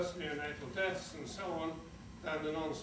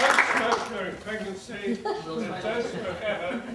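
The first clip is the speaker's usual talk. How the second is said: loud, raised-pitch admonition; emphatic warning; 4 semitones higher, 11 dB louder than usual. Real, not acted. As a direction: call it out firmly over the applause. Parting health warning over applause.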